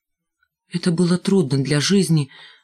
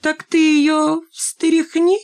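egging on; 11 semitones higher, much slower than usual. A narrator's reading. Shmakova goads Valka.

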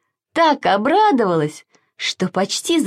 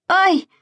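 high yelp of pain; sharp ouch; 11 semitones higher than usual. A narrator's reading. Sasha is stung by nettles and yelps. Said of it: "закричал Саша".

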